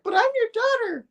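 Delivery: very high mock-daughter squeal; pleading daughter. Daughter protesting to her attacking father, high-pitched mock plea. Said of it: "She's like".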